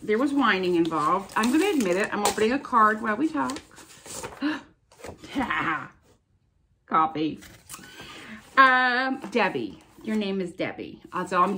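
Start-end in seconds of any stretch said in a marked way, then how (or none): 5.0-5.9 soft gasp. Real, not acted. Opening a card.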